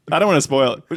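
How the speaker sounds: usual speaking voice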